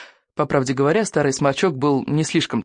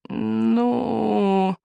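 long, higher-pitched drawn-out creak; creaky drawl; 7 semitones higher than usual. A narrator's reading. Old healer hesitating over the cure, creaky drawl. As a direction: draw the word out in a creaky voice. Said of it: "протянул он скрипучим голосом".

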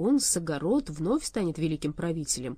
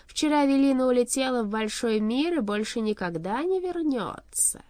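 emphatic proclamation; 7 semitones higher, 5 dB louder than usual. A narrator's reading. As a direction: proclaim it grandly, stretching the end. King proclaims witch is gone.

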